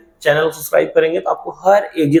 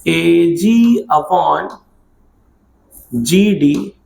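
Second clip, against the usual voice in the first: slow dictation; much slower than usual. Shown as a demonstration. Dictating the ratio to write.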